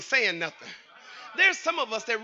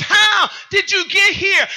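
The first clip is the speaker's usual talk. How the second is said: high, slow preacher's cry; shouted preaching question; 9 semitones higher, 8 dB louder, slower than usual. Acted. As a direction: cry it out to the congregation, slowly. Turns the quote into preaching.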